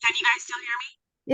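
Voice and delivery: tinny echo over a bad line; like talking inside a metal tank; tin can voice. Real, not acted. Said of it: "It sounds like a tin can".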